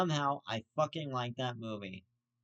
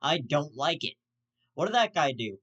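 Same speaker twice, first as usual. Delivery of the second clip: ordinary delivery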